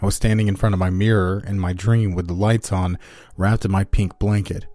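ordinary delivery, nothing marked

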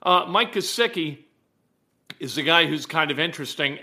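usual speaking voice

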